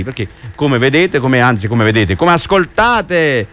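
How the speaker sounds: normal talking voice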